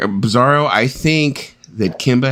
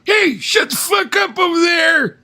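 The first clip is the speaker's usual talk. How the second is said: put-on high, slow yell at the noisy kids; grumpy old man shout; slower than usual. Old man yelling at kids across the street, shouting. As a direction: holler like a cranky old neighbour. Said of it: "becoming that old man who's like".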